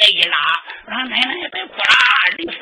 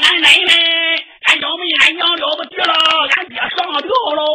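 高声急喊，最后一句加快; 向全家惊慌报信地喊; 大声呼喊; 5 semitones higher, 3 dB louder than usual. Acted. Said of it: "我就出去喊了". (惊慌大喊)

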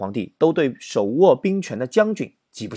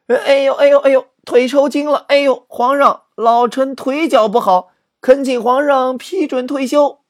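put-on an old general overplays a cramp to retire; faked leg cramp; 11 semitones higher, 5 dB louder than usual. Another general fakes leg cramp. (夸张地装腿抽筋，高声哀求退休)

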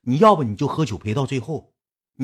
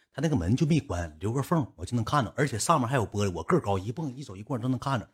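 usual speaking voice

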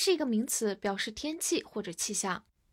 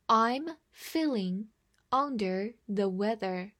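slow, measured model reading; slower than usual. Shown as a demonstration. Teacher reads slowly so the class can follow. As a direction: read it slowly, word by word. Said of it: "慢读一遍".

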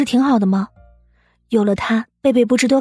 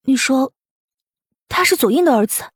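higher, startled questioning; agitated surprise; 6 semitones higher than usual. Acted. Wife learning whose son the boy is, agitated and surprised. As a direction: echo the news in raised-pitch disbelief. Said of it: "你这么激动做什么".

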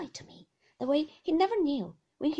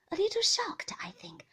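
ordinary delivery, nothing marked

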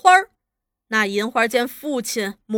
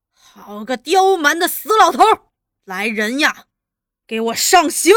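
loud, furious outburst and order; angry command; 3 semitones higher, 7 dB louder than usual. A narrator's reading. Interrogator ordering torture of old man, angry. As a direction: curse him, then call in your men.

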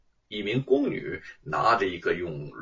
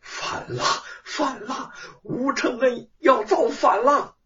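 raging outburst; 11 semitones higher than usual. A narrator's reading. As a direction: shriek that he's rebelling. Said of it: "西宫娘娘暴怒道".

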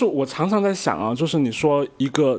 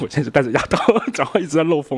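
talking through braces with a lisp; laughing, air leaking past braces; retainer; faster than usual. Real, not acted. (戴牙套说话漏风)

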